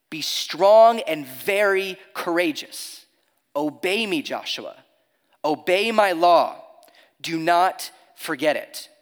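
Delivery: slow and weighty, each word pressed; emphatic command. Retold or imitated. God commanding Joshua, slow and emphatic. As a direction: lay down the command with slow force. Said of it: "God is extremely emphatic with him".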